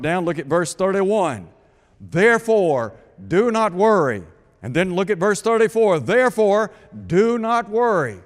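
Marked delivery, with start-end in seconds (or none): 2.0-4.2 "do not worry" pressed out loud and firm; emphatic command. Retold or imitated. Preacher quoting Jesus' command to the congregation, emphatic. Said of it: "Jesus emphatically states". 6.0-8.2 "do not worry" higher and louder, each word driven home; emphatic command. Retold or imitated. Preacher repeating Jesus' command from verse thirty-four, emphatic. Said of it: "Jesus emphatically states".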